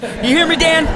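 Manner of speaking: high, strained rant from a fed-up dad; acted angry dad. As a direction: scold in a hammed-up high pitch. Dad scolding crying son.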